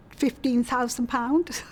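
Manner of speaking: ordinary talk, nothing marked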